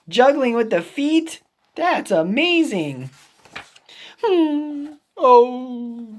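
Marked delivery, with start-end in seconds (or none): none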